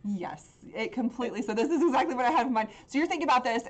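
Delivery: usual speaking voice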